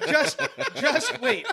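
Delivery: very high, loud hold-on; loud excited interjection. Real, not acted. Storyteller holding off impatient listeners, loud and high. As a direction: cut in over the others, high and loud. Teller cuts in on laughing listeners.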